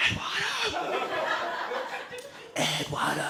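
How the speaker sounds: high, soft searching call; calling out softly. Acted. Two friends wandering past sleeping campers' tents, high hushed calling. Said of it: "just walking past going".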